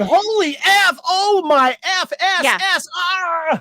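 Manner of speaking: hammed-up high, loud outburst; mock screaming with censored swearing, drawn out at the end; Haley freaking out. Host imitating co-host's reaction, high hammed-up yelling. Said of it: "I just see Haley going".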